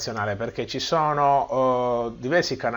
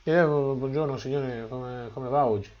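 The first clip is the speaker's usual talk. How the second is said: small, soft and hesitant, barely voiced; timid soft greeting; 5 dB quieter than usual. Imitating a person trained to speak softly, greeting a gentleman. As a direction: greet like someone used to whispering. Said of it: "è abituato a usare quel tono lì".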